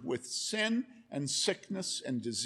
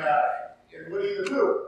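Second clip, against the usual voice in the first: ordinary delivery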